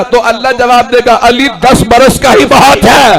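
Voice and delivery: high-pitched oratory building to a shout; impassioned sermon crescendo; full-force preaching. Real, not acted.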